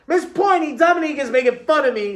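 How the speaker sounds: high, loud tattling whine; whining. A narrator's reading. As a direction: whine it fast to the teacher.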